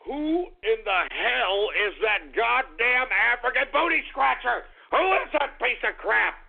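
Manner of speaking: ordinary talk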